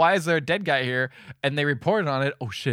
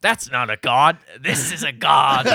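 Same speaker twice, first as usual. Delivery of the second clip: mock Australian; 4 semitones lower, slower than usual. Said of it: "And he's like".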